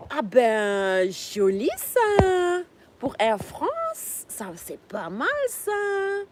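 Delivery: high, slow, hammed-up French gushing; mock French delight. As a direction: gush like an impressed Parisian, drawn out. Hammed-up French delight at pilot job.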